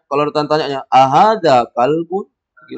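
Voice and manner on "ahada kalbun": Ahada kalbun slow and louder with a rising question tune; question intonation. Shown as a demonstration. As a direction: ask it as a real question, slowly. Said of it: "Pakai intonasi bertanya".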